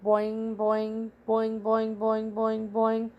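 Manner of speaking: evenly repeated twangy frog call; imitated frog noise, steadily repeated; frog boings. A narrator's reading. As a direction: bounce out each boing like a frog. Frog's call imitated in narration.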